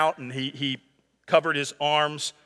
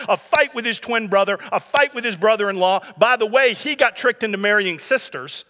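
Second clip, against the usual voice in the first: ordinary delivery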